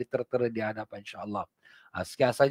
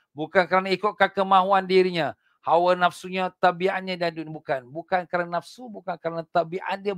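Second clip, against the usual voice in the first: fiery preaching; 6 semitones higher, 4 dB louder than usual. Acted. Preacher warning the congregation about hypocrites, fiery. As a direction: drive the warning home from the pulpit.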